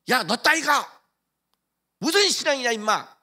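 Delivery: hammed-up scornful yell; mock insult. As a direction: sneer at him like a bully. Someone insults church member.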